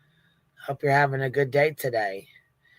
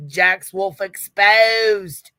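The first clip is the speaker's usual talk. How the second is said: mock British accent; 4 semitones higher, 6 dB louder, much slower than usual. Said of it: "my accent".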